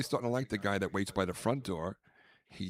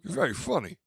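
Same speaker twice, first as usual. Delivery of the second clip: Armand Assante impression; 10 semitones higher, 4 dB louder, faster than usual. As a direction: imitate his flat, intense compliment. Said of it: "You sounded just like him".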